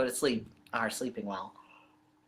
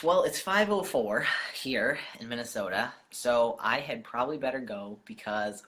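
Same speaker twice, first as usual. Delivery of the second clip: ordinary talk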